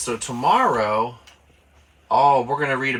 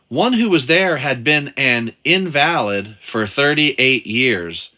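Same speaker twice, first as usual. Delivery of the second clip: Bible reading; 5 dB louder than usual. Acted. Reads the Bible passage aloud.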